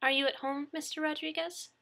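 calm dispatcher. A narrator's reading. Dispatcher checking the victim's location, calm. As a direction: ask with professional gentleness.

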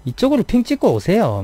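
high Busan-tinged Seoul attempt; a Busan native trying to sound Seoul; Busan person faking Seoul speech. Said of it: "서울만 쓰려고 노력하는 부산". (서울말 흉내 내는 부산 억양)

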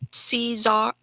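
ordinary delivery

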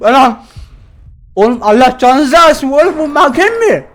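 loud shocked outburst; jump scare scream. Real, not acted. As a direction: shriek then blurt it out.

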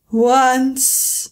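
slow mournful creature voice; a weary old sea creature beginning his tale; Mock Turtle voice. Mock Turtle begins his sad story to Alice, mournful. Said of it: "said the Mock Turtle".

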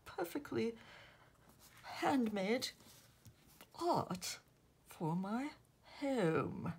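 slow, amateur British royal impression; King Charles. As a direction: play an enraptured monarch, word by measured word. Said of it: "Do I sound like King Charles?".

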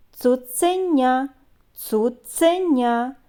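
"Цуценя" slowly stretched syllable by syllable; drawn-out word. Shown as a demonstration. Teacher helping children hear the sounds, drawn-out "Цуценя". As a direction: stretch "Цуценя" so both ц sounds are heard. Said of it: "Вимовляємо слова протяжно".

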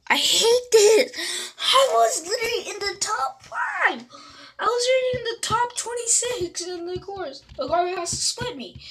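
very high, tearful-sounding rant; upset wailing complaint. Real, not acted. Player losing a top place in the game, upset wailing.

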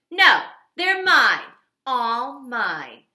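loud, high and slow grab; guard it jealously; greedy grouchy ladybug; possessive. Acted. Grouchy ladybug refuses to share.

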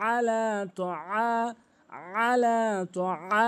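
chanted আলা, তো আ with throaty ain; correct ain drill. Shown as a demonstration. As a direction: draw out আলা, তো আ from mid-throat. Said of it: "বারবার practice করবেন". (আলা, তো আ, আইন টেনে)

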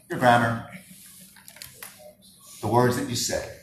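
usual speaking voice

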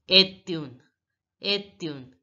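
est une as "et-yoon", said twice; est une repeated as one joined word; linked words. Shown as a demonstration. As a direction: carry the t of est over into une. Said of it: "we are going to link the words".

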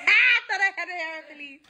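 laughing. Real, not acted. Laughing at herself.

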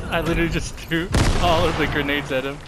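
exasperated yell. Real, not acted. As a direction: shout it in disbelief. Frustrated after wasting grenades.

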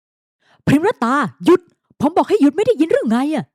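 loud, high furious call after her; angry shout. A narrator's reading. Owner shouting after the woman storming away, furious. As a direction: shout her down as she walks off. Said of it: "เขาตะโกนไล่หลังด้วยความโกรธ".

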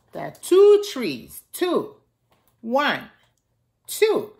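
gentle storybook reading for little ones; reading to children. Acted. Miss Nana reading Little Cloud aloud to the children, storybook voice. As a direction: read it out like story time for kids. Reads picture book to children.

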